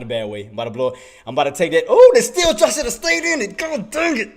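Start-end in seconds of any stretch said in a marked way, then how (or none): 1.9-4.3 sudden high-pitched outcry; exasperated regret. Real, not acted. Trader sees he should have stayed in the trade, exasperated. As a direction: wail it in comic dismay, starting loud.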